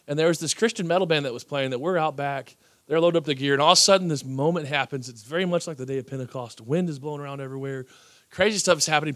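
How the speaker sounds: usual speaking voice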